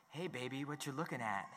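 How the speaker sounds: low, soft flirty murmur; sexy voice. Retold or imitated. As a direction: purr it like a flirting husband. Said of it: "his sexy voice says".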